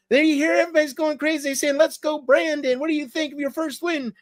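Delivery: high, fast falsetto reporter parody; imitated TV interviewer. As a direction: rush it in a high mock interviewer voice. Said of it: "and she said".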